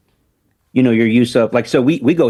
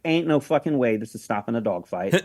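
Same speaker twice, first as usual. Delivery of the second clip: muttered disbelief; 4 semitones lower, 8 dB quieter than usual. Retold or imitated. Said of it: "I'm like".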